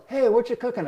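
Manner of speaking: calling out cheerfully to a neighbor; boy calling to a stranger at his grill, mild yell; retold yell. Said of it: "Will yells".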